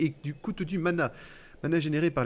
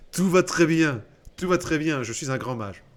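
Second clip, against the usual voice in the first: mock grand mage; 2 semitones higher, 7 dB louder than usual. Mock reassurance as a great mage.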